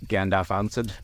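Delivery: ordinary delivery, nothing marked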